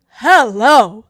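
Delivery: loud, emphatic hello; big punchy exclamation of approval; appreciative exclamation. Real, not acted. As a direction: belt it out like a thrilled fan. Exclaims over motorcycle scene.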